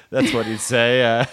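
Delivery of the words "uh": usual speaking voice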